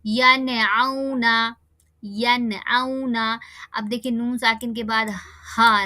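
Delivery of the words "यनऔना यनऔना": यनऔना with the नून said clearly, slow; recited example. Shown as a demonstration. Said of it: "जल्दी पढ़ेंगे और साफ-साफ पढ़ेंगे". (यनऔना में नून साफ़ ज़ाहिर करके पढ़ना)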